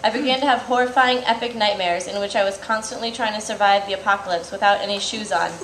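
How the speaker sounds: ordinary talk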